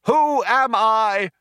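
rally the crowd over a tannoy; crying out to crowd. A narrator's reading. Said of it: "cried the man".

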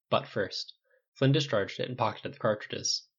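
ordinary delivery

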